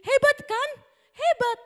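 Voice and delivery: very high, loud amazed exclamation; excited praise. Acted. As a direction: thrill the kids with amazement. Teacher praises Peter excitedly.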